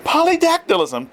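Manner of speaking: a high, ringing shout. A narrator's reading. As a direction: shout it again for the dean. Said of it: "Turn repeated his shout for the dean".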